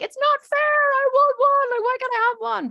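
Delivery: loud, high mock tantrum wail; hammed-up whining child; child's tantrum. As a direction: wail it like a protesting kid, dragged out. Imagined child's tantrum over treat.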